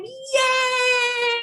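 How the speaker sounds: high excited cheer; a bright kids'-show yay. Acted. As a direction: cheer it for the kids. Host cheers for story time.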